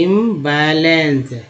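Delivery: इम्बैलेंस slowly, split after "im"; broken into parts. Shown as a demonstration. Teacher showing learners how im joins, इम्बैलेंस slow and split. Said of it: "तोड़ तोड़ के कैसे पढ़ेंगे".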